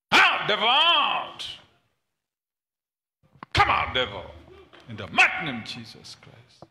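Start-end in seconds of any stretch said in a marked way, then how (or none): none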